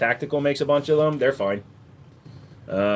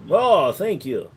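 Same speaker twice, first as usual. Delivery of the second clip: ordinary talk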